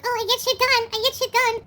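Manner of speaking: high mocking bragger voice. Mimicking a critic bragging, in a high mocking voice. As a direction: ham up a smug brag. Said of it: "you can brag and be like".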